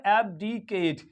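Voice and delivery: Abdicate stretched very slowly; slow pronunciation demo. Shown as a demonstration. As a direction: sound out Abdicate syllable by syllable. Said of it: "क्या कहलाता है?".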